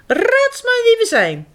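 high teasing animal voice; playful creature voice. Acted. Costumed animal teases director, high playful voice. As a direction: tease them to guess.

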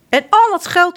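the sheriff raises his voice, claiming the coin. Acted. Sheriff's line, high and loud.

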